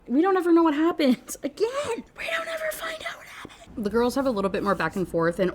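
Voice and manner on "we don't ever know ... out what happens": high squeal dissolving into laughter; laughing exasperation. Real, not acted. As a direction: complain through laughter until your voice gives out.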